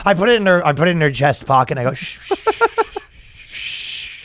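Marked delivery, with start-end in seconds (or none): 1.9-4.2 soft, long hushing sounds; drawn-out shushing. Acted. Shushing a stranger in a comic bit, soft hushing. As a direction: hush her with slow, breath-only shushes. Hushes woman in acted bit.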